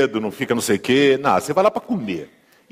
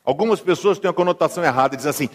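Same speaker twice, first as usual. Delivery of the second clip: normal talking voice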